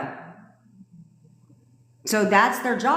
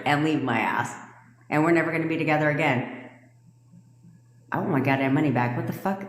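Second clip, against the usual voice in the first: normal talking voice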